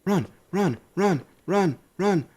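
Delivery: panicked high shouting; yelling while running away. Real, not acted. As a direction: scream at the dog in panic. Attacked by wolf, fleeing.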